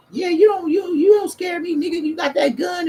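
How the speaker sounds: high, taunting woman's voice; high mocking woman voice. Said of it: "the bitch kept saying".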